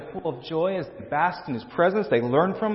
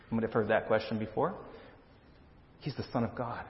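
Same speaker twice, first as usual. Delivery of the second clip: ordinary delivery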